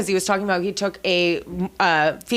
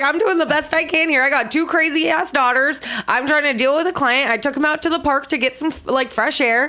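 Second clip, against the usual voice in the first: a higher, harried mom voice, lightly voiced; frazzled mom quote; 6 semitones higher than usual. Friend retells a client mom answering a critical lady, higher and harried. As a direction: play a stressed mom brushing off a stranger. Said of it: "She's like".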